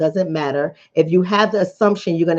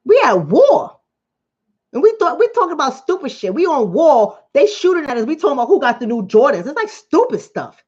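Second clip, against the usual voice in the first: fired-up Bronx rant; heated; 5 semitones higher than usual. Real, not acted. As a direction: let the Bronx come out in frustration.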